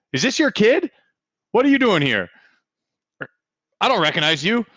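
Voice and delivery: high, questioning worker; suspicious. Acted. Worker questioning a stranger, high and suspicious. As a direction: press the stranger for answers.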